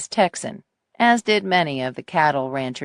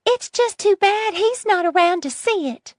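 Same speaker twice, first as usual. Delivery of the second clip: put-on spiteful old lady voice.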